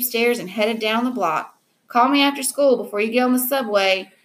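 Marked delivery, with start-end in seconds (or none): 1.9-4.1 mild yell. A narrator's reading. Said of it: "Mom yelled at me from the window".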